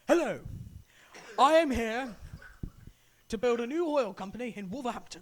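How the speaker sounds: lightly voiced posh mayor; Mayor of London impression. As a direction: do a grand London mayor speech. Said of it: "talking like the Mayor of London".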